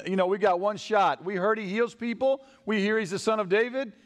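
high, mounting excitement; calling out. Acted. Blind men seeing their one shot, calling out excitedly. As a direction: build phrase by phrase to a loud peak. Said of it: "they're calling out".

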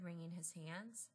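ordinary delivery

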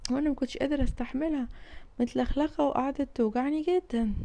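soft, high, relieved; quiet scolding. Acted. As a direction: scold gently after the fright. Relieved father scolds softly. (أب بيعاتب بهدوء بعد الخضة)

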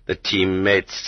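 usual speaking voice

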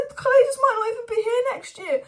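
high, loud, strained rant at the owners; frustrated shout. Real, not acted. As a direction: yell it, fed up with the owners. Fan ranting at owners.

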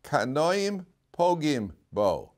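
Kanoim Pogim Bo spelled out slowly word by word; slow teaching stress. Shown as a demonstration. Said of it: "The first three letters are".

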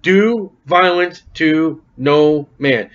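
slow, even reading with every word laid out; slow scripture reading. Shown as a demonstration. Preacher reads the verse out slowly. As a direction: read the verse slowly to the congregation. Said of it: "Notice what's it say".

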